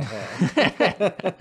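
laughing. Real, not acted. Laughs at quoted line.

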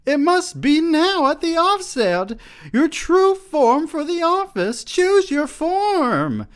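very high, loud shrieking voice; sustained theatrical scream; screamed demand. A narrator's reading. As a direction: shriek out urgent orders, stretching the last. She screams commands at him.

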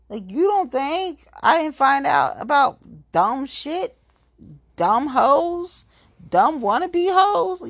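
high sneering taunt; high taunting rant. Real, not acted. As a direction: scoff at them from up high. Ranting taunts.

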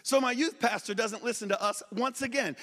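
normal talking voice

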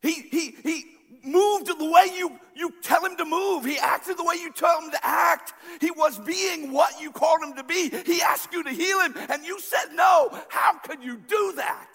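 high, loud, accusing cry at God; preaching at full force. Acted. Grieving son accuses God over his dad, loud preaching cry. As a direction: hurl the grief at heaven, full voice.

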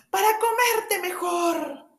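high, loud, slow shouted threat; wolf's pounce. Acted. The wolf shouts at the girl before swallowing her. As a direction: roar it as the wolf leaps.

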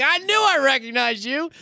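high, loud laughing officer; amused cop voice. Retold or imitated. Retelling the jail officer who recognized him, high and laughing. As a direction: squeal it like the cop who knew you. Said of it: "He's like".

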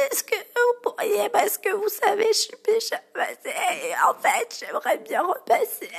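very high strained croak, barely voiced; croaky lost voice. Customer with no voice asking to go ahead, in a very high strained croak. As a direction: squeeze the words out with no voice left. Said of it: "c'était du genre".

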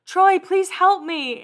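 high, drawn-out mock plea. Singer begging [name] for help, mock pleading. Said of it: "She's like".

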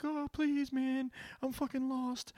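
a high, slow, whiny voice for the caught thief; pleading thief. Retold or imitated. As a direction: beg like a caught car thief. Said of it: "And he's like".

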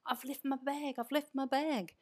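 a touch of Irish lilt, fretting over a lost bag; panicked Irish accent. Retold or imitated. Mum telling her sister about the lost bag, in a lightly Irish accent. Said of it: "you went into an Irish accent there".

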